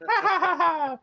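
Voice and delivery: shrill mock cartoon chuckle; high cartoon mouse laugh. Mickey Mouse laugh imitated.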